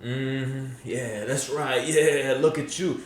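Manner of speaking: hushed smug creep voice. Retold or imitated. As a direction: purr it like a self-satisfied creep. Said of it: "And you're like".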